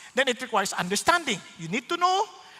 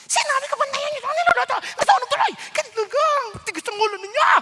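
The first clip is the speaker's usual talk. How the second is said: high, loud scolding yell; angry shouting; 13 semitones higher, 5 dB louder, faster than usual. Retold or imitated. Host scolding the household over burnt food, angry shouting. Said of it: "Ganyan, ang lakas ng boses".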